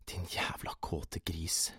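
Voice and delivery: slow, soft whisper to oneself; whispered thought. A narrator's reading. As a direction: hiss it inwardly, drawn out. Silent self-reproach, thought. (langsom hvisking for seg selv)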